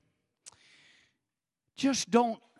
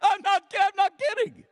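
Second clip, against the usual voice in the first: much higher and louder insistence; excited insisting; 7 dB louder than usual. Real, not acted. Assuring the laughing congregation, excited. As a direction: protest over the laughter.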